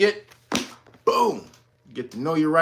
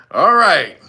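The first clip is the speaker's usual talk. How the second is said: normal talking voice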